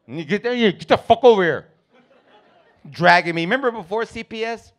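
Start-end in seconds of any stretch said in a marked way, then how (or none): 0.1-1.7 put-on gruff dad ordering kid; angry dad voice. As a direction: bark at the kid to come.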